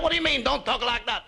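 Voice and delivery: put-on high, indignant Italian-accented comeback; mock Italian accent. Mother answering her embarrassed son, indignant Italian accent. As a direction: throw it back like an outraged Italian mama. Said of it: "You're not Italian".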